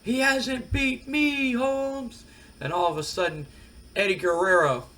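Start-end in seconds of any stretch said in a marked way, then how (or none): 0.0-2.2 put-on slow, much higher voice in a thick Spanish accent. Wrestler interrupting the promo, thick Spanish accent taunt. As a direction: drawl it out in a heavy Spanish accent, teasing. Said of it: "in a very thick Spanish accent goes".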